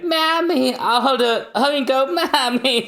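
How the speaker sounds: put-on high child voice; little girl calling. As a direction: call out to mommy. Child Robin calls her mother.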